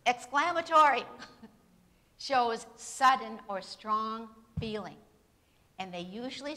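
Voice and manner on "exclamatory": much higher, loud burst on Exclamatory; dramatic exclamation. Shown as a demonstration. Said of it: "what are they like".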